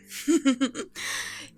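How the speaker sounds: rippling regal chuckle; amused chuckle. Acted. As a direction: chuckle at a clever child. Amused the child guessed the queen.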